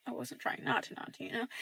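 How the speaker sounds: whispered guilty aside; a secret whispered to the camera. Real, not acted. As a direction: drop to a whisper, sheepishly admitting it. Confessing eavesdropping as an aside.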